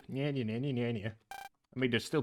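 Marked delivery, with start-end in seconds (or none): 0.1-1.1 mocking sleep talk. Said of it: "I heard you talk in your sleep".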